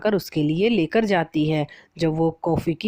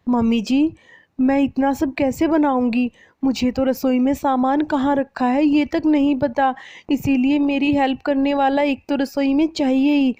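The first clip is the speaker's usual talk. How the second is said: much higher, anxious pleading; worried young bride; 9 semitones higher, 3 dB louder than usual. Acted. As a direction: ask for help, a bit overwhelmed.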